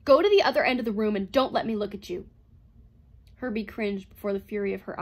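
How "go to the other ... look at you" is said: loud, sudden furious order; shriek. A narrator's reading. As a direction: shriek it at the robot. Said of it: "shrieked at the robot".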